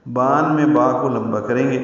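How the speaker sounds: ordinary talk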